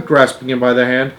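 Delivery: usual speaking voice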